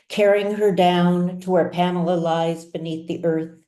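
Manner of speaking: ordinary delivery, nothing marked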